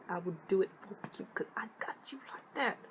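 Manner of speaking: breathy whispered promise; playful whisper. Acted. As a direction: whisper it like a little secret. Playful sign-off promise to viewers.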